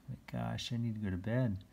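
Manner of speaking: ordinary delivery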